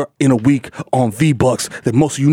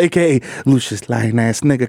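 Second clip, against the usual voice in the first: normal talking voice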